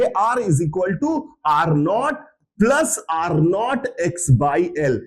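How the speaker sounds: very slow, each term spaced out; slow dictation. Shown as a demonstration. Teacher dictating the formula for students to write, very slow. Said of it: "तो आप सीधे लिख दोगे".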